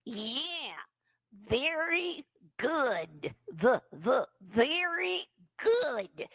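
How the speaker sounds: slow nasal "very good" as "thery good". Shown as a demonstration. Teacher drilling the nasal dummy voice. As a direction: drawl it through the nose, lips still. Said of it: "Put that little nasal sound in there".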